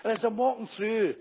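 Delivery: ordinary talk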